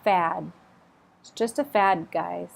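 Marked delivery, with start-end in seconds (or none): 0.0-0.5 Fad ending in a crisp d stop; clear final D stop. Shown as a demonstration. Teacher modelling fad, definite final stop. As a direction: close fad with a clean d. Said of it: "a definite stop".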